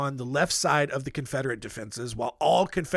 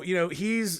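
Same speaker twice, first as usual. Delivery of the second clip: ordinary talk, nothing marked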